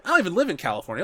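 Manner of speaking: ordinary delivery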